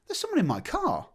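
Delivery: high, alarmed cry of disbelief. Retold or imitated. He spots a thief in his car, shocked high cry. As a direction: pitch it way up in shock. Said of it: "And I went".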